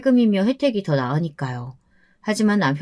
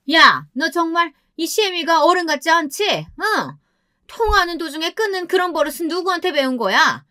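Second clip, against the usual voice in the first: very high, indignant telling-off; angry yelling; 10 semitones higher than usual. A narrator's reading. Mother-in-law calling back after being hung up on, angry yelling. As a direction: tear into her in a high, outraged voice. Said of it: "다짜고짜 소리를 지르시는".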